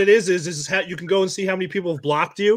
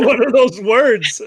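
laughing; 6 semitones higher than usual. Real, not acted. Laughing at the Maine talk.

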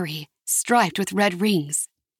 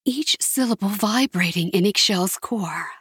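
ordinary delivery

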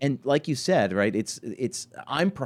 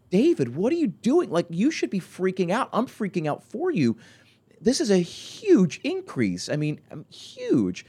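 excited disbelief; 10 semitones higher than usual. Retold or imitated. Owner hyping a client's results.

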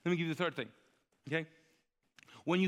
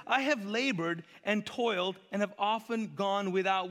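high, slow, indignant recounting; Paul's rant; 5 semitones higher, slower than usual. Acted. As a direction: stretch out each hardship as a grievance. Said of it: "Paul on a rant".

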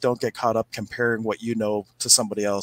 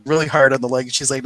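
ordinary talk, nothing marked

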